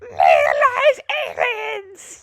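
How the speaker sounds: very high, slow squeaky Toad voice. Acted. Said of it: "You wanna hear my Toad voice?".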